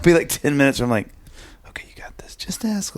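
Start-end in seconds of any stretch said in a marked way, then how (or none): 1.7-2.3 breathy whisper to himself; a father coaching himself in a whisper before asking his son; whispered self pep talk. Retold or imitated. 2.3-3.0 hushed self urging. Retold or imitated. Pep talk to himself.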